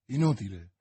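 low, resigned repetition; grim resignation. A narrator's reading. Athos telling his friend help is pointless, grim resignation. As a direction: say it sunk and hopeless.

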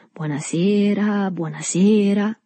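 slow, drawn-out jovial greeting; uomo gioviale. A narrator's reading. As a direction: greet the room jovially, stretching the words. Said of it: "dalla faccia rossa e gioviale". (saluto gioviale e strascicato)

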